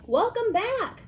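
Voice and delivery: high, loud cheery greeting; mad scientist greeting. A narrator's reading. Mad scientist welcoming the teacher back, high and loud. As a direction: sing out a welcome.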